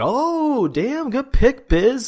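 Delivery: high, drawn-out mock ooh of approval; impressed group cheer. Imitating the panel reacting to Biz's pick, impressed.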